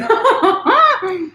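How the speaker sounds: a burst of high real laughter. Real, not acted. Streamer laughing at herself on camera, high laugh.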